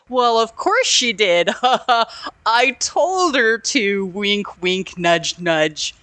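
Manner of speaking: hammed-up phony assurance with a fake chuckle; lying through teeth. Husband covers himself to his wife, hammed-up insincerity. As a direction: play a bad liar, slowing into heavy wink-nudge hints. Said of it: "he's lying through his teeth".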